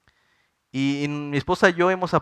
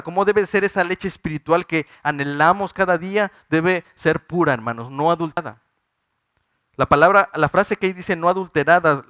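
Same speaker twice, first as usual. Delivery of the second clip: fervent preaching; 3 semitones higher, 4 dB louder than usual. Acted. Preacher climaxes on the verse.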